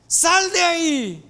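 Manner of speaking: high, loud, drawn-out call; shouted summons. Acted. Jesus calls dead Lázaro out, loud high shout. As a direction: shout it commandingly, as if into a tomb. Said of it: "Jesús grita".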